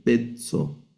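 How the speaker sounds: "pezzo" with a plain E in the stressed first syllable; diphthong as E. Shown as a demonstration. Teacher reading the Greek word, first syllable as E. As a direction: say "pet-so" in two parts, first vowel E. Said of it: "vamos a leer".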